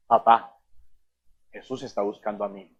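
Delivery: low voice, dropping very soft; quiet confiding. Acted. Believer telling father about Jesus, quietly confiding. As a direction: break it gently, low and hushed.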